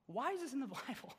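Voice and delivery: laughing disbelief. Real, not acted. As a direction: ask it in disbelief, cracking up. Incredulous, breaking into laughter over the passage.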